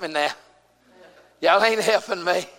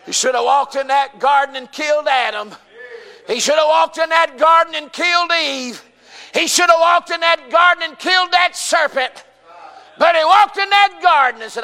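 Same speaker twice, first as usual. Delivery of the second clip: high, loud, rhythmic pulpit cadence; full-force preaching; 9 semitones higher, 6 dB louder than usual. Real, not acted. Preacher telling of God in the garden, full-force preaching. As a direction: build each repeated phrase in a raised chant.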